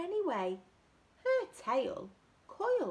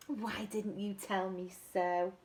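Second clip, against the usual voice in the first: plain reading voice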